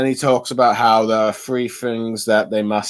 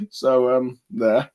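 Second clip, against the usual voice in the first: usual speaking voice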